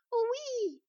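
put-on high, loud little-girl coo; drawn-out, high happy squeal of assent; delighted cooing. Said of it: "roucoulèrent-elles".

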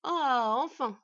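loud cry of relief; delighted smith exclaiming; rejoicing. A narrator's reading. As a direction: let out a big, pleased sigh of words. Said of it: "se réjouit le forgeron".

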